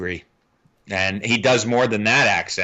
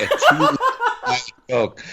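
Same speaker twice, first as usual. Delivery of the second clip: laughing; much slower than usual. Real, not acted. Amused at co-host chugging Diet Coke.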